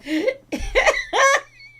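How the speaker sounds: loud, high-pitched laugh. Real, not acted. Owner laughs at dog's antics, high laughter. Dog lays paw down.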